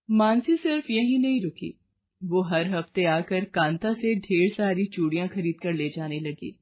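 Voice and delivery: plain reading voice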